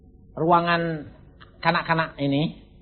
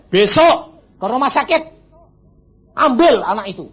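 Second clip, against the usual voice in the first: loud command; 8 semitones higher, 9 dB louder than usual. Retold or imitated. Retold command to wife.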